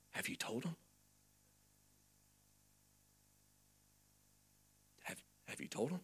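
very soft, conspiratorial question; low, hushed, careful asking; furtive hushed reply. Retold or imitated. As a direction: ask it secretively, mimicking their caution. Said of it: "I would look back and forth like this, like they did".

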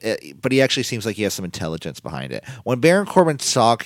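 normal talking voice

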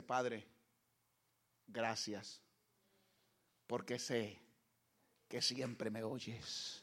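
low, soft, slow prayer; hushed prayer. Acted. Jesus thanking the Father before the tomb, hushed. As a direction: pray it softly upward.

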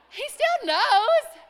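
high, loud laughing punchline. Real, not acted. Preacher to congregation, laughing at the punchline. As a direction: laugh out the point.

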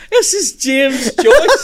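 high, loud, slow incredulous laugh-talk; laughing disbelief. Real, not acted. Stunned that Joyce wrote this, laughing. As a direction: marvel through laughter.

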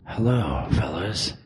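low, soft game-character voice; put-on deep voice. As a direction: drop low and quiet for the hero. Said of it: "stupid fucking voice".

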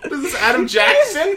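high cracked put-on whine; mock Sandler voice. Co-host jumps in voicing Sandler, high and cracked. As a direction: squeak it out like a bad Adam Sandler.

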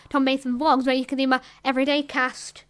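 usual speaking voice

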